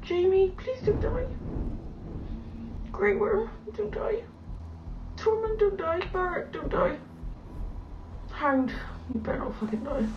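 high, strained begging; pleading for characters. Real, not acted. Fan begging favourite characters to survive, high and frightened. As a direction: plead with each name, frightened.

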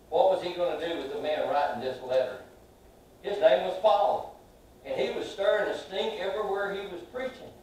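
ordinary talk, nothing marked

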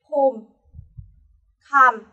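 "khom, kham" each closed on M; final M. Shown as a demonstration. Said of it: "vẫn đọc là chữ M".

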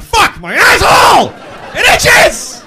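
very high, loud desperate yell; itchy shouting. Retold or imitated. Desperate man with itchy backside shouting, high and loud. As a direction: scream out the itch. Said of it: "I still would have been going".

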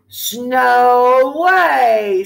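drawn-out, very slow elf call; odd elf voice. A narrator's reading. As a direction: stretch every sound out. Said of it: "said the elf".

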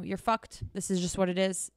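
ordinary delivery

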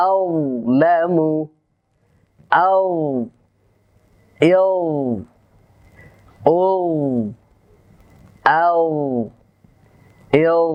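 slow drawn-out Arabic recitation; Quranic recitation practice. Shown as a demonstration. Teacher reciting Arabic practice words for students. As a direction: recite each word slowly with tajweed.

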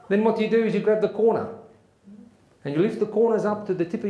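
emphatic South African military lecture; South African accent. Acted. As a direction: give step-by-step orders in a South African accent. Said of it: "try my best South African accent".

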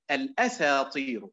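الأساطير said as الأسى طير, a break before طير; الأساطير with a clear pause between الأسى and طير; split into two words. Shown as a demonstration. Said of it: "بالأداء الآخر الصحيح".

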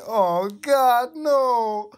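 real cringe yelp at the text; horrified outburst. Real, not acted. Reader reacting in horror to the fanfic, loud high cry. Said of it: "reader's horrified outburst".

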